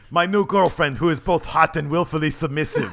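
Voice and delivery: high, loud, punchy wrestler boast; Rusev impression. Quoting a wrestler on his girlfriend, loud boastful impression. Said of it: "How do you describe her?".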